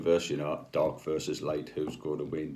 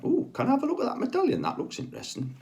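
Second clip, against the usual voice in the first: mock innocent; faster than usual. Feigned innocence asking for the medallion.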